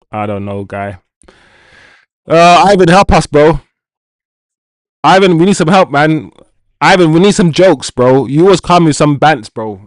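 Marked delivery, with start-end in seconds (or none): 2.3-9.8 loud, high call to Ivan. Real, not acted. Streamer calls loudly to viewer Ivan for jokes. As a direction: shout out to a friend in chat.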